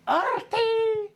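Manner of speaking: very high, stretched cry; dragon's lunge. Acted. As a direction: screech the name like a lunging monster. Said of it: "goes with his big teeth".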